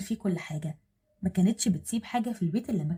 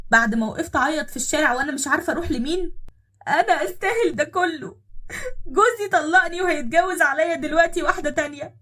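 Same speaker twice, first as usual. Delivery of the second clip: louder, higher tearful reproach breaking into crying; 6 semitones higher, 5 dB louder than usual. Acted. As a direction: pour out the hurt through tears. Wronged woman reproaches, crying on line 27. (عتاب باكٍ بصوت عالٍ)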